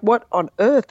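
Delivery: an outraged cry at a government mistake; retold anger. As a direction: burst out in indignant disbelief. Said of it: "I was just so angry".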